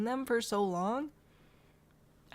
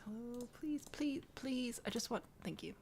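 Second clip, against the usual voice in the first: soft, higher-pitched begging; soft pleading; 4 semitones higher, 6 dB quieter than usual. Real, not acted. Player coaxing the game, soft pleading. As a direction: plead quietly under your breath.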